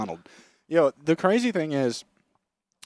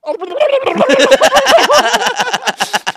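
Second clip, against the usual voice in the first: high squeaky laugh while drinking; gargling dummy laugh; 13 dB louder than usual. Retold or imitated. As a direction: cackle through a mouthful of water. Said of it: "gets a bottle of water and goes".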